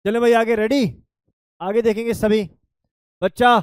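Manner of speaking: loud, energetic call to the class; loud classroom push forward; loud rallying call. Real, not acted. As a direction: wake the room up and move on. Teacher rallies class loudly.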